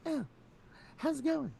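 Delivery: very high mocking falsetto; squeaky put-on politeness; mocking mom. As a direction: mimic mom's niceness in a silly high voice. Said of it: "I just started mocking her".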